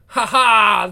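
a short, loud triumphant cackle; gloating laugh. Acted. Villainess gloating over her lassoed enemy, loud laugh. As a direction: crow over the beaten foe.

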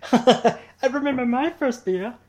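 words squeezed out through high laughter; cracking up while remembering; laughing. Real, not acted. Recalling his first beer to viewers, laughing hard.

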